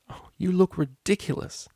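high-pitched, amused teasing; stifled laugh. A narrator's reading. Cellist amused at her dishevelled friend, stifled laugh. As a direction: hold back a laugh while teasing. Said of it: "the cellist snuffed a laugh".

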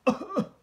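sharp high open cough; demo cough. Shown as a demonstration. Teacher asks children if this cough is right, loud open cough. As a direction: cough out hard, uncovered. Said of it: "¿yo lo estoy haciendo bien?".

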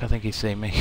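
ordinary talk

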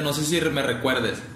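normal talking voice

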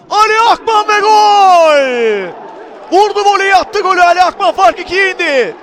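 long, loud goal scream; screaming football commentator. Real, not acted. Goal call in match commentary.

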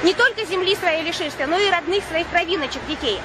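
usual speaking voice

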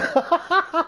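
high, amused laugh; sudden genuine cackle; real burst of laughter. Real, not acted. Laughs at king on toilet.

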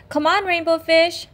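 high, bright fish voices calling. Acted. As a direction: call him over to play.

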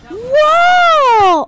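loud, high startled shout; startled whoa. Real, not acted. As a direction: yell it out in sudden surprise. Sudden surprise in game.

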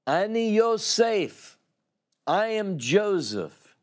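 a touch of trembling reunion emotion; understated weeping revelation; through tears. Acted. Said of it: "through tears, he's shaking".

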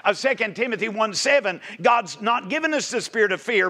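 ordinary talk

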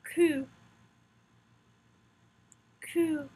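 French letter name "Ku", said as one isolated syllable; "Ku" as a French teacher models it; French pronunciation drill. Shown as a demonstration. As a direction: say "Ku" the French way, for learners to repeat. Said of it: "Practice the pronunciation with me".

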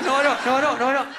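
high, loud, rapid-fire protest; hammed-up flustered protesting; panicked denial. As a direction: deny it frantically. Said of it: "I was like".

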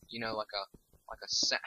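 usual speaking voice

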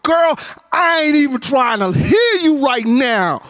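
sassy dismissive snap at his wife; with attitude. Retold or imitated. Husband snapping at his wife during the blackout, with attitude. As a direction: brush her off with attitude. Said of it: "I said it with attitude".

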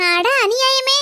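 loud outraged cry of injustice; loud exclaim. Acted. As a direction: wail it out at full volume. Mother-in-law loudly complains alone.

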